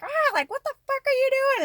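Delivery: high squeaky voice for the cat; cat's outraged voice. Retold or imitated. Voicing her singed cat's outrage, high and squeaky. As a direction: voice the startled cat yelling back. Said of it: "she's like".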